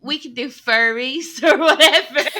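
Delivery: laughing mock quote. Mocking AI fans, laughing.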